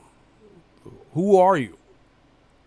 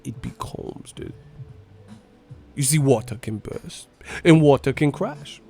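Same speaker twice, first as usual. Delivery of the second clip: Bruce Lee impression; 2 semitones lower, 4 dB quieter than usual. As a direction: drift into a calm martial-arts master. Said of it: "Bruce Lee would be like".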